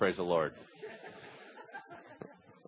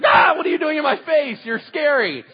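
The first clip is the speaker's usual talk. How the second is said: put-on frightened child; 10 semitones higher, 7 dB louder than usual. Niece frightened by loud uncle.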